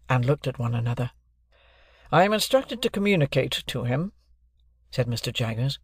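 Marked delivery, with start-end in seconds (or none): 2.1-4.1 raised, deliberate lawyer's voice; stern lawyer. Acted. Jaggers introducing himself to Joe and Pip, stern lawyer. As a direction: announce yourself like a cool London lawyer.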